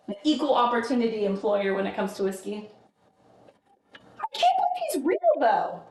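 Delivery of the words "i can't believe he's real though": squeaky high amazed disbelief. Real, not acted. Telling chat the Irishman turned out real, high amazed disbelief. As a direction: sound truly floored he exists.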